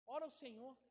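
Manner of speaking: ordinary delivery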